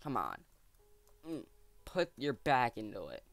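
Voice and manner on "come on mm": ordinary delivery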